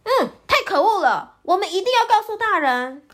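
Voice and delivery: high, fast and loud outrage; indignant squirrel. A narrator's reading. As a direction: huff it out, fired up. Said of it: "气冲冲地说".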